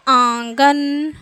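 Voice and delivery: आंगन drawn out, nasal आँ then गन; teacher answers her own question: आंगन, slow; slow answer demo. Shown as a demonstration. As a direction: answer with आंगन slowly and clearly. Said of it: "ये क्या उच्चारित होगा".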